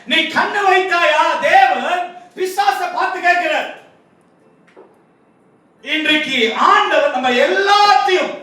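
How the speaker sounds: high, declaimed challenge; impassioned preaching. Acted. God questioning Satan about Job, impassioned preacher's declamation.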